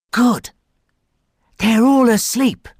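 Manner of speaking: put-on high, whispered-feel child voice; boy's thought voice. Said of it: "he thought".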